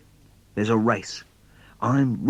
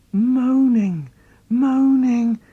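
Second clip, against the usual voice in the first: high, louder fearful moan of the word; spooked; 11 semitones higher, 4 dB louder, slower than usual.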